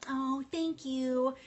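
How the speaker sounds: slow, drawn-out imitated thank you; mock gushing thanks. Retelling a viewer's reply, mock gushing and slow. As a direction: stretch it out like a bashful reply. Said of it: "And she was like".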